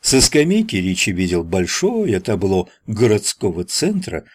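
usual speaking voice

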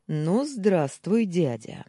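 slow, drawn-out greeting; pointed greeting. A narrator's reading. Nephew reveals himself to shocked uncle, pointed greeting. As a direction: let the hello land slowly, with intent.

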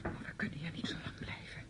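urgent hushed whisper. Acted. Niece whispering to her aunt that they cannot stay. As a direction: keep it secret, push her to leave.

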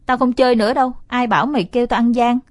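loud, sulky refusal; sulky anger. Acted. Brother refuses to keep playing, loud sulky anger. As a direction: pout and refuse. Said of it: "là mặt giận".

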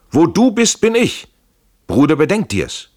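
very high, loud acted cry; urgent exclamation. A narrator's reading. Friends decide in haste to flee together, urgent exclamation.

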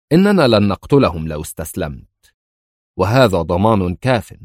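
ordinary delivery

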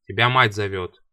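loud, sharp announcement; громко и резко. A narrator's reading. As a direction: call it out hard and loud. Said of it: "голос любовника был громким и резким". (громко и резко)

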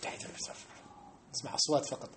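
mock mindless whispered recitation; how worshippers mumble the tashahhud, whispered. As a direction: breathe the words out, unvoiced. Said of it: "كيفاش نقرأها إحنا؟".

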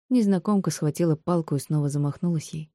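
ordinary delivery

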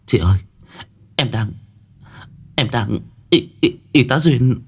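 acted tearful stammer, climbing in pitch; halting, choked-up attempt to get the words out; overcome, stuttering between pauses; bursting into tears. A narrator's reading. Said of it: "Hiền bật khóc".

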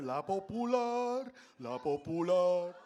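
slow, sing-song announcer voice; old store ad voice. Pretend. Old furniture store jingle, slow announcer sing-song. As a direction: sell it like an old radio ad. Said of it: "like you work at the fucking old Muebleria La Popular".

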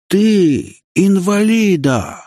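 high, long-drawn bellow; outraged roar. A narrator's reading. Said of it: "взревел ветеран". (возмущённый рёв)

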